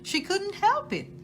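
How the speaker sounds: plain reading voice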